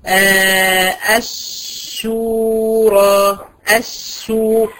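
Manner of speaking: very long "shsh" held in الشورى and الشو; doubled shin. Shown as a demonstration. Teacher showing silent shin then shin with damma, drawn-out "sh" in الشورى. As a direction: slowly stretch the silent ش, then round into "shu". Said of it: "شين ساكنة وشين مضمومة".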